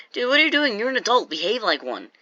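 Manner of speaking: high-pitched incredulous scolding, easing down; exasperated scolding. Retold or imitated. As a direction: imitate a coach telling someone off. Coach's acted scolding in quarrel.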